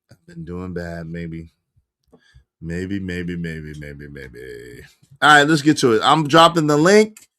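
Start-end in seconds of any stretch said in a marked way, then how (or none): 0.1-4.8 fading away. Pretend. Trailing repeated maybe.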